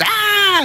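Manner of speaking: high, drawn-out scream; acted shriek of horror; mock scream. Blindfold off in the courtyard, high mock scream. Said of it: "she looks up and she screams".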